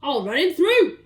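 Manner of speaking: loud fierce threat; shouted. Acted. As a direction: bellow like a duellist. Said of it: "he shouted".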